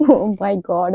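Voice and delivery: usual speaking voice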